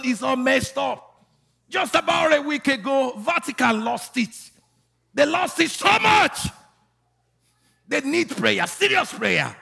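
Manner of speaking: high, loud prophetic preaching; full-force preaching. Real, not acted. Preacher prophesying life over the congregation, full-force preaching. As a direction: declare it in long shouted cries.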